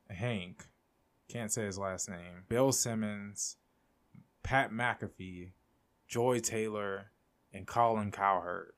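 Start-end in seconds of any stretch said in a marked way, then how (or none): none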